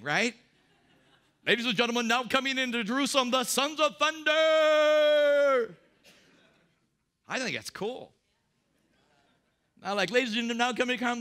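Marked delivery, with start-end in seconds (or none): 1.5-5.7 MMA ring announcer. Retold or imitated. Pastor introducing the apostles as fighters, booming ring announcer. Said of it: "It sounds like an M M A thing". 10.1-11.2 quick, louder throwaway announcer intro; rushed mock announcer. Pastor mocking the nice-guy version, hurried mock announcer. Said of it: "It sounds like an M M A thing".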